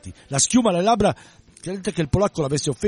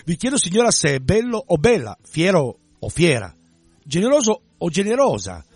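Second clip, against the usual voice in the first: slow, pointed, louder words, leaning on each o/a ending; subtle sarcasm; 4 semitones higher, 6 dB louder than usual. A narrator's reading. The Pole taunts Ragia about the captain's true sex, sarcastic. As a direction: needle her, drawing out every feminine form. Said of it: "con sottile sarcasmo".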